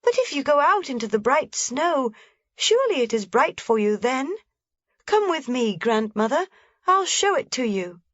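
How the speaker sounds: put-on lively little girl voice pitched high; a small girl pointing things out eagerly; young Heidi. A narrator's reading. Heidi telling grandmother about the shutter, bright and eager.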